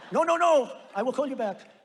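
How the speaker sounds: high, loud panicked cry of no; alarmed protest. Retold or imitated. Macron hearing the wine tariff threat, panicked loud protest. Macron caves under pressure.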